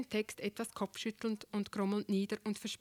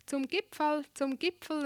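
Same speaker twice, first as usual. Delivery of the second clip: higher, lilting call onward; 5 semitones higher, 4 dB louder than usual. A narrator's reading. Dream Lucia hurrying ahead to the summit, calling out. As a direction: call ahead lightly, pitch lifted. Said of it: "rief sie".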